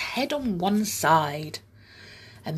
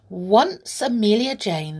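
usual speaking voice

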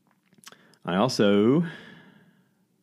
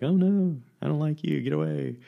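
much higher, hammed-up refusal; lightly voiced romcom lead pushing him away; mock heroine rebuff; 9 semitones higher than usual. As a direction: play the heroine brushing off the hero. Acting out the meet-cute rebuff.

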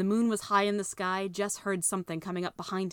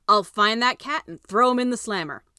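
blustering sheriff; 4 semitones higher, 9 dB louder than usual. Acted. Sheriff promises to catch cat.

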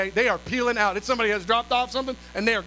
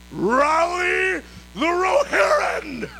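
slow, high, loud rallying shout; battle cry; 7 semitones higher, 4 dB louder, much slower than usual. Retold or imitated. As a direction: stretch it out like a war cry. Calling everyone to action.

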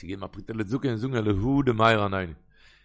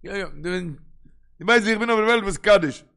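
raised, higher-pitched protest; indignant retort; 7 semitones higher, 8 dB louder, faster than usual. Acted. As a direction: throw the question back loudly at father. Acted indignant retort to father. (אויפגעבראכט און הויך)

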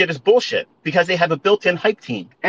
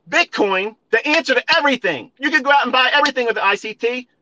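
mocking hype; 9 semitones higher than usual. Mocking crypto believers' hype.

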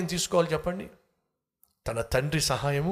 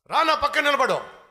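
high-pitched commanding call; high, insistent command; bossy fox; 10 semitones higher, 4 dB louder than usual. Acted. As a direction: order the lion over sharply. Fox orders lion beside him.

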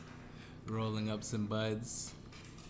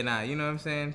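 ordinary talk, nothing marked

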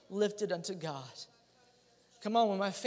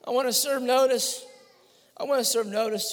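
shouted, high fervent preaching; preaching at full force; 10 semitones higher, 11 dB louder than usual. Acted. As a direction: roar it like a revival preacher.

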